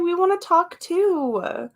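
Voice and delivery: high, slow little bird voice pleading to join in; voicing the birds who want to talk like the dogs; chirpy birds. Telling viewers her birds chirp back at the dogs, in a high bird voice. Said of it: "they're like".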